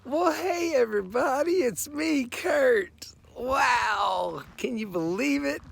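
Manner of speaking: very high, loud vlog hello; excited greeting. Real, not acted. Traveler opening a clip for his viewers, excited greeting. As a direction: call it out high and amazed, drawing out the words.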